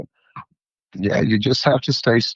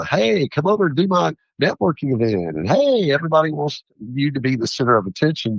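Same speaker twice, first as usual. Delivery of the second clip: high-pitched mock-eager coaxing; eager invitation; 7 semitones higher than usual. People inviting a vendor to networking events, mock-eager. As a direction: imitate people luring you over with an excited "hey".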